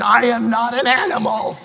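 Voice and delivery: rising to a full protest yell. Retold or imitated. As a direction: shout it back at the screen. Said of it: "I want to yell at the T V screen".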